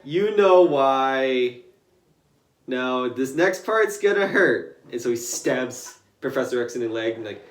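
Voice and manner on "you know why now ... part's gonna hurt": very slow, louder, drawn out; slow menace. Retold or imitated. Deadpool threatening Professor X, slow and teasing. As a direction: savour each word threateningly. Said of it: "He's like".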